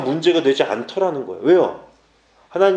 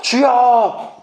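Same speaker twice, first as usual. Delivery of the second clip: high imitated cry to God; fervent prayer cry; 7 semitones higher, much slower than usual. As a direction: call out like someone praying aloud. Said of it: "어떻게 기도하는 줄 알아요?". (높게 부르짖는 기도 흉내)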